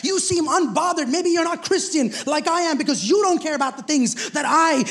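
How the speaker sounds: high-pitched, driving sermon delivery; impassioned preaching. Acted. Preaching at full force.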